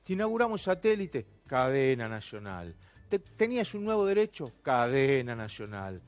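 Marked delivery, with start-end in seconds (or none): 1.5-2.8 mocking refrain. Mocking refrain after each example. 4.6-6.0 low, drawn-out mock-solemn refrain. Mocking refrain after each example.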